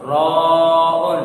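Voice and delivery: "रॉउन" with a heavy, rounded R, long drawn out; thick letter. Shown as a demonstration. Teacher showing a student the letter is read thick, heavy drawn-out "रॉउन". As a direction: chant "रॉउन" slowly with a thick R. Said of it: "इसको भी मोटा पढ़ेंगे।".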